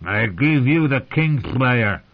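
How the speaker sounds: slow, loud, thick proclaiming voice; thick slobbery voice. Acted. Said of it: "in that thick, slobbery voice of his".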